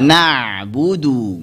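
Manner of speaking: "Na'budu" slow, the ayn flowing briefly; slight flow. Shown as a demonstration. Teacher modelling tawassut, "Na'budu" with briefly flowing ayn. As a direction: let the throat sound in "Na'budu" flow a little. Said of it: "ini ngalir sedikit".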